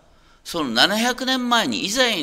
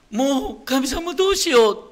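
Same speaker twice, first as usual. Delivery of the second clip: desperate cry; 8 semitones higher, 5 dB louder than usual. Retold or imitated. どん底で神に嘆く心の声.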